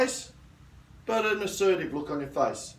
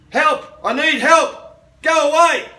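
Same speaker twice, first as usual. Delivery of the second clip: full loud cry for help, much higher; yell for help; 11 semitones higher, 13 dB louder, slower than usual. Shown as a demonstration. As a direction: scream for help to drive the attacker off. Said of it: "yell out even more".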